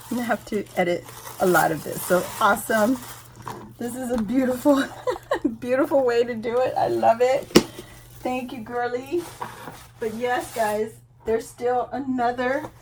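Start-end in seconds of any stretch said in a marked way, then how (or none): none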